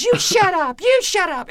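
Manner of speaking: high, loud shriek of a heckler; imitated yelling back. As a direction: act out the drunk woman yelling back. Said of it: "they were yelling so loud".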